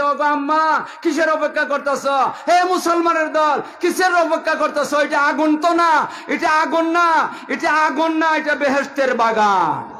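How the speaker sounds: put-on high, loud preacher's shout as the child; child shouting to mother and the believers, high and loud; child calling out. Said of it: "বাচ্চাটা মারে ডাক দে বলতেছে".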